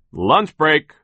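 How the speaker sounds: loud, high foreman's holler; shouted order. A narrator's reading. Foreman calls the workers to lunch break, shouting. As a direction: bellow it out across a noisy workplace. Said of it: "banged his pots together and shouted".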